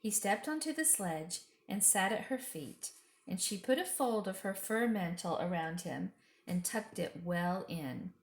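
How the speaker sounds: ordinary delivery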